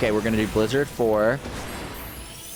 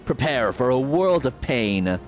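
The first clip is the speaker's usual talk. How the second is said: higher, mocking villain taunt; slow theatrical villain menace; 4 semitones higher than usual. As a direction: gloat over the coming battle. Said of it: "I love playing a villain voice".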